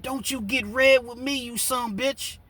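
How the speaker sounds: high, strained backwoods threat; angry Kentucky backwoods voice. Retold or imitated. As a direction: snap like a riled hillbilly ready to fight. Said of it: "when he jumped up and said".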